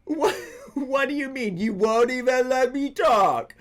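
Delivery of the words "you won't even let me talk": mocking echo. Mocking caller's complaint back.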